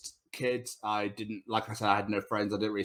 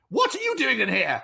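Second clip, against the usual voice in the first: high, shrill-pitched teacher outrage; mock indignant teacher; 3 dB louder than usual. Teacher catching Tom back in class, mock high outrage. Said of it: "she went".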